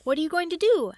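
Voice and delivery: loud, high worried question; anxious question. Acted. Head fears what Gideon will do, anxious. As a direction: fear what he'll do next.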